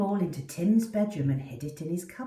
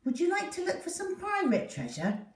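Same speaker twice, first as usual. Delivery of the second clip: put-on higher, inviting boy's voice; eager young boy; 12 semitones higher, faster than usual. Tim inviting Poppy to play, high and eager. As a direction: tempt the dog into a game.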